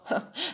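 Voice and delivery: short high nasty laugh; a sneering burst of laughter; cruel laugh. A narrator's reading. Heckler laughing at the slaves, cruel laugh.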